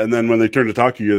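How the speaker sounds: usual speaking voice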